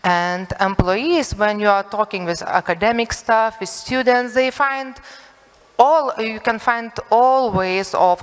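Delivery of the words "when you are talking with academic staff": ordinary talk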